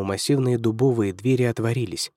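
plain reading voice

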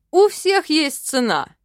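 loud, triumphant demon declaration; demon proclamation. A narrator's reading. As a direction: proclaim it like a law.

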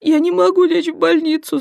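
high, loud tearful wail; a young mother wailing to the doctor. Acted. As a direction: cry it out in despair. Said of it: "заплакала блондинка в голос".

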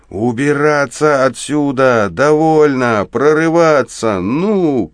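urgent high-pitched cry, words stretched; shouting. A narrator's reading. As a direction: yell the orders, pitched well up. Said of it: "кричит он и бросается". (настойчивый высокий крик)

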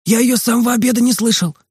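put-on high-pitched boy's call from a window; Makar calling out. A narrator's reading. Makar calls to the boys from the window, high calling voice. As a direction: call it out high, without real shouting volume. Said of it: "крикнул нам Макар из окна".

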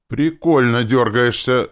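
loud, drawn-out gleeful jeer; enjoy the victim's struggle out loud; slow, high taunt of amusement; cheerful mockery. A narrator's reading. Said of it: "весело оценил главный урод".

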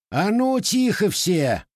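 high, loud bark, slowing on the last words; old ataman silences the room, barking; barked order. A narrator's reading. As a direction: slam the table and bark. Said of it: "рявкнул Макар Лукич".

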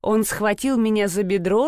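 ordinary delivery

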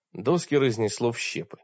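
ordinary delivery, nothing marked

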